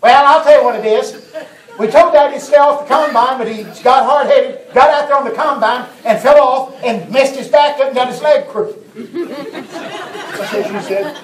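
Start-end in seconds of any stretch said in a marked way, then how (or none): 0.0-8.8 raised, loud, laughing daughter's voice; loud mock daughter telling on Daddy; voicing the daughter. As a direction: act out the exasperated daughter.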